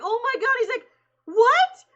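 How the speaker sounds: high, loud astonished gasp of words. Retold or imitated. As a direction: squeal it in disbelief. Quoting the actor's astonishment.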